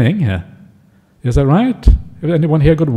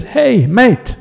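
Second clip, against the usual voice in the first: high-pitched mock Aussie hail; Australian call-down; 8 semitones higher than usual. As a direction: call down in half-convincing Australian. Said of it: "he shouts down to his friend".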